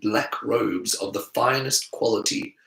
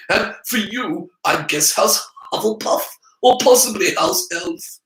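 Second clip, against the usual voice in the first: put-on high, condescending boy voice; haughty boy, higher pitched; snobbish Draco; 8 semitones higher than usual. A narrator's reading. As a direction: talk down to the commoner. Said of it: "Draco voiced in story".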